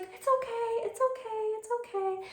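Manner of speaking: high, soft it's okay repeated; soothing whisper to pet. Retold or imitated. Student soothing the bunny hidden in her sleeve. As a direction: coo softly to the hidden animal. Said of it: "And then she's like".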